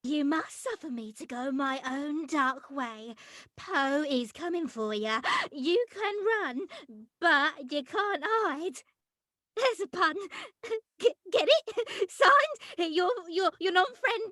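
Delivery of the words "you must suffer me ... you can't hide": low, soft sinister reading; softer, lower menacing villain read; menacing note. Acted. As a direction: read it darkly like a villain's threat.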